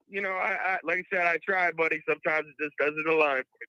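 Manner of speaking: ordinary talk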